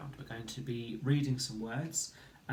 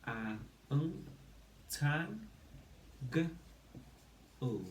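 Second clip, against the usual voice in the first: sounding out; 3 dB quieter than usual. Shown as a demonstration. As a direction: model each sound, then blend the word. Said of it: "So we say".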